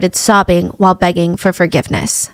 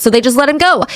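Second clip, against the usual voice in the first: usual speaking voice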